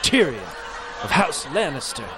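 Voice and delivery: grand self-introduction. Acted.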